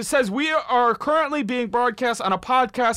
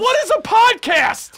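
outraged shout; 7 semitones higher, slower than usual. Acted. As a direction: bellow the question in disbelief. Outraged at cohost.

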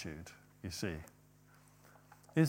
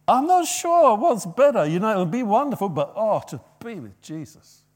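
longing sigh; 6 semitones higher, 7 dB louder than usual. Retold or imitated. Paul longing for Jesus.

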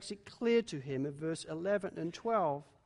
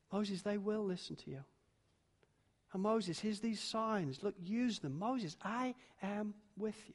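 reassuring; 10 dB quieter than usual. Acted. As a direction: hush it down and gently promise him. God reassures Moses.

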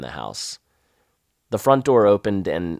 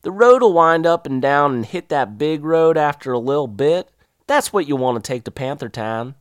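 put-on old Southern woman; 7 semitones higher, 5 dB louder than usual. Voicing the local woman.

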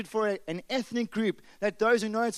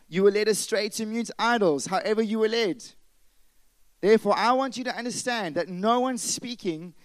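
scripture reading; 2 semitones higher, 5 dB louder than usual. Acted. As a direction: read it out as holy writ to the church. Reading scripture aloud with force.

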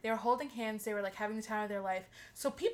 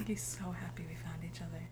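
whispered, softer mock-romantic line; 5 semitones lower, 6 dB quieter than usual. Teasing the boy's rain line in a soft whisper. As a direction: hush it like a corny confession.

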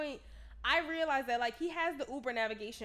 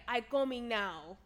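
slow, imitated Spanish-accented English; mock Spanish-accented driver; 3 dB louder, slower than usual. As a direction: butcher a Spanish accent slowly. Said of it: "This is all in a Spanish accent".

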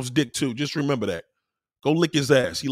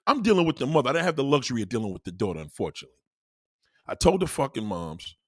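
ordinary talk

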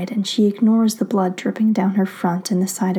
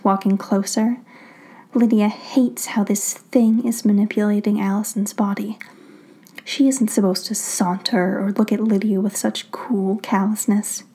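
plain reading voice

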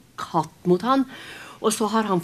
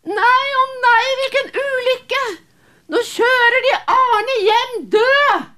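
much higher, loud wailing cry, slow at the end; women screaming; 8 dB louder, slower than usual. Acted. Village women wail as Arne is carried home dead, loud high crying. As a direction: wail it like horrified women seeing a dead man. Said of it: "kvinner som skriker".

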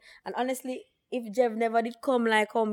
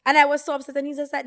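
normal talking voice

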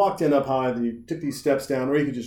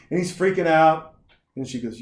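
ordinary talk

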